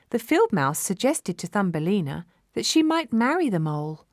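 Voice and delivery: ordinary delivery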